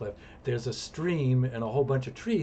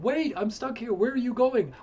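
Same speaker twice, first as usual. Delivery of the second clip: put-on higher, worried girlfriend calling; lightly voiced girlfriend pleading up the cliff; stuck girlfriend; 11 semitones higher, 4 dB louder than usual. Girlfriend stuck below, calling up higher and worried. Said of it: "she's like".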